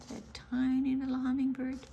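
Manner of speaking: ordinary delivery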